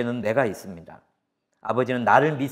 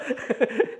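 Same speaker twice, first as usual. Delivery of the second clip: chuckle; 11 semitones higher than usual. Real, not acted. Laughs about hamburger.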